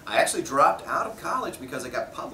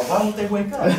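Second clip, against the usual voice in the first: high, silly cartoon distress; mock-innocent high-pitched wail; mock dismay; 10 semitones higher, 7 dB louder than usual. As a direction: plead like a sanitized kids' dub, half laughing. Toned-down kids' TV line acted out.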